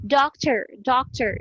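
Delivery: "doctor" with a schwa before the final R; American pronunciation. Shown as a demonstration. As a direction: model "doctor" as DAWK-ter, twice. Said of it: "dili siya doktor".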